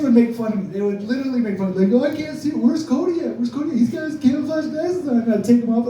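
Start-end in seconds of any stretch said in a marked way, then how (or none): 1.9-5.2 put-on high, teasing kid voice; mocking kids. Kids teasing the nerd about his glasses, in a high mocking voice. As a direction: taunt him like a schoolyard bully.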